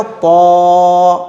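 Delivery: "पौ" as a heavy emphatic T with long rounded vowel; thick-sound demo. Shown as a demonstration. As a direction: hold "पौ" full and level. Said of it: "मोटा करके". ("पौ" को मोटा करके खींचें)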